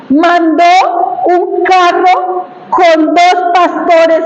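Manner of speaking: very slow, word by word; slow emphatic wonder. Real, not acted. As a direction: spell out the marvel, drawing out each word. Recalca el regalo de Dios. (lento y enfático, palabra por palabra)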